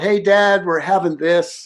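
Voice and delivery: retold family shout across the house; yelling out. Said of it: "we would all yell out".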